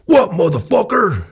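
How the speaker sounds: high, loud, slowed-down holler back; gruff reply from inside. Retold or imitated. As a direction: imitate a man yelling from indoors. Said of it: "I hear this voice inside".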